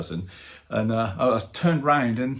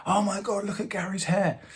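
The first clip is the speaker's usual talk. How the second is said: high excited gasp; mock awe at the telly; excited kids; 11 semitones higher than usual. Kids at the window seeing the hair, high excited.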